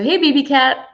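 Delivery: ordinary talk, nothing marked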